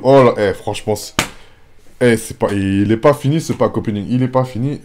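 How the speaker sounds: can't believe the pull, keep shouting; real stunned excitement; excited disbelief. Real, not acted. Still reacting to secret pull.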